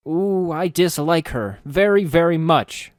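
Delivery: hammed-up slow pout of dislike; mock dislike. A narrator's reading. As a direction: overplay a frown you don't mean. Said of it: "frowned deeply, almost exaggeratedly".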